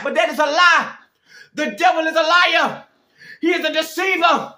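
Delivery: high, loud, urgent preaching cadence; full-force preaching. Real, not acted. Preacher pleading with listeners to come back to God, impassioned preaching. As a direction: plead with the congregation from the pulpit.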